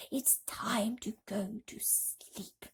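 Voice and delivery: slow sleepy whisper; soft slow bedtime whisper. Acted. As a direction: hush them to sleep. Grasshopper calls bedtime.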